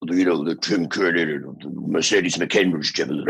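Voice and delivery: slightly low demon growl parody; stock devil voice. Shown as a demonstration. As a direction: do the Tim Curry style devil everyone does. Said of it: "I didn't wanna do the".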